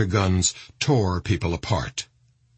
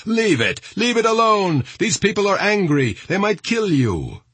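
high, urgent shouting; shouted warning; 12 semitones higher than usual. A narrator's reading. People at the back of the crowd to the Saudis, shouting. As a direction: call out a warning, pitched way up. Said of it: "shouted at the Saudis".

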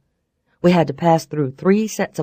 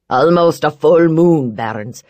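louder rough veiled threat; gruff man voice; 2 semitones lower, 5 dB louder than usual. Acted. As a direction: warn him with a smile. McCabe warns.

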